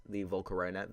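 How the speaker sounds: ordinary delivery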